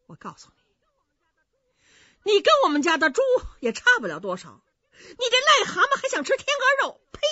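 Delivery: high, loud, fast mocking tirade; scornful scolding. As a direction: spit the insults at him. 女人骂冯子都. (尖声快嘴地骂人)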